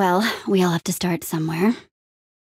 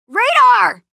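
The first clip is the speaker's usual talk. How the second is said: loud, high-pitched shout of a name; calling out; 14 semitones higher, 7 dB louder than usual. Acted. Hero shouts for Radar, calling out loud and high. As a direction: yell it to reach someone out of earshot.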